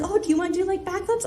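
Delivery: high, soft, eager question; small, high voice offering a suggestion; imitated nice guy. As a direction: imitate a helpful instructor, pitched right up. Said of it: "trying to help me wiggle up and being nice".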